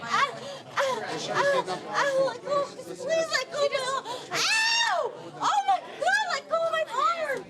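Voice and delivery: sharp cries of pain; high screaming, real pain; arm twisted back. Real, not acted.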